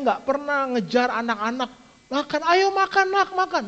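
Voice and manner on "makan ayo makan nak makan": high coaxing parent voice, imitating; a hint of a parent chasing a child to eat; mock pleading parent. Preacher imitating a parent coaxing a child, high and pleading.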